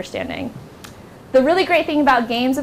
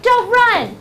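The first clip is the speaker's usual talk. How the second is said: high teacher's warning shout; mock yell; 11 semitones higher, 4 dB louder than usual. Teacher warning kids in a mad scramble, high yell. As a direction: yell it across a scrambling class. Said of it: "this is when I'm yelling".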